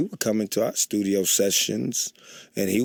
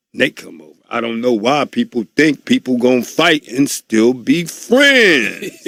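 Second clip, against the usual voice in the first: ordinary talk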